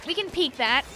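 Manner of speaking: usual speaking voice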